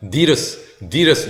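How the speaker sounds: "디르스" as DEE-ruhs, the initial A swallowed; first vowel dropped. Shown as a demonstration. Teacher showing what learners will hear, clipped-off first vowel in "디르스". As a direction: say "디르스" as a listener would hear Adidas. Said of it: "디르스 이렇게 들린다는".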